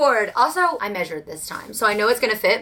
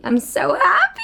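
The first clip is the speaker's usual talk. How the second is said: giddy squeal; 9 semitones higher than usual. Real, not acted. Gushing to viewers about finished room, giddy squeal. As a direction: burst out laughing with joy. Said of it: "And I'm so happy".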